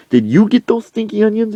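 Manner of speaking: high-pitched, hammed-up questioning; pestering demand. Coach accosting a woman, high pestering demand. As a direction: interrogate in a pushed-up voice.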